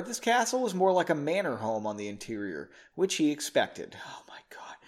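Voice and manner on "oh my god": breathy unvoiced oh my God; whispered dismay. Real, not acted. Reader whispering in dismay at her own prose. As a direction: mutter it under your breath in dismay. Aside on bad writing.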